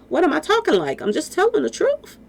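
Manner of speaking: answering the trolls, outraged but sure; defiant retort. Real, not acted. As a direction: throw it back at them, indignant.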